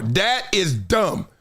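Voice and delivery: "That is dumb" drawn out word by word; slow emphatic. Real, not acted. Host calling out a silly remark, slowly emphatic. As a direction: lay each word down slowly for weight. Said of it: "start calling everybody for what they are".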